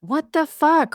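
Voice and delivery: high, loud startled disbelief; surprised outburst. Real, not acted. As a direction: blurt it out in shock. Jealous of the other mic.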